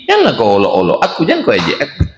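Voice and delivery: ordinary delivery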